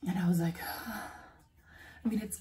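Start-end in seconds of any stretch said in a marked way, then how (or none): 0.6-1.2 whispered sigh. Real, not acted. Sigh at the offer.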